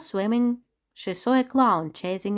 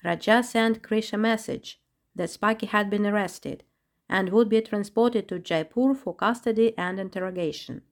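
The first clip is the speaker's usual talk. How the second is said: plain reading voice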